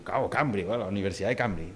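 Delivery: usual speaking voice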